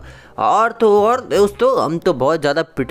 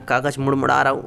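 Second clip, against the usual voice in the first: usual speaking voice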